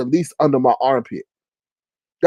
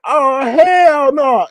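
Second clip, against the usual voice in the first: high, long-drawn refusal; comic indignant objection; mock outrage; 8 semitones higher, much slower than usual. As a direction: stretch it out in scandalised disbelief. Retold refusal on seeing monitor.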